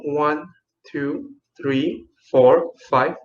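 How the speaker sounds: drawn-out slow count. Shown as a demonstration. Teacher demonstrates slow counting to kids. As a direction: count one to five slowly.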